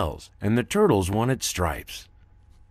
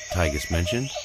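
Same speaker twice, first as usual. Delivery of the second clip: usual speaking voice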